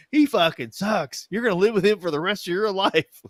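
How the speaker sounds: high, loud retold rant; exasperated outburst. Sister warning the bride about her husband, loud high exasperation.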